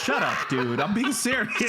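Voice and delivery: high-pitched objection through laughter; real amused exasperation; laughing protest. Real, not acted. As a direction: insist while cracking up. Protests while the others laugh.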